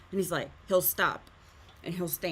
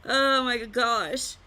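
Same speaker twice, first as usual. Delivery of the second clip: laughing; 4 semitones higher, 8 dB louder, much slower than usual. Real, not acted. After laughing fit.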